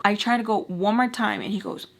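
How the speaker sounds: ordinary talk, nothing marked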